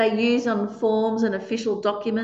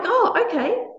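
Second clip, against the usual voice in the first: pleased surprise; 11 semitones higher than usual. Pretend. Reader reacting to clear request.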